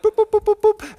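playful boops. Retold or imitated. Imitating rapid-fire questions.

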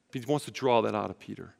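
ordinary talk

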